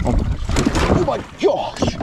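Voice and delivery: awestruck gasp. Real, not acted. Huge bass on the line.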